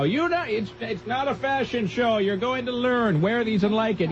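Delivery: high, drawn-out mom voice; imitating scolding mom. Mom at Goodwill telling kid about school shoes, high mock scolding. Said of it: "when my mom would take me to Goodwill".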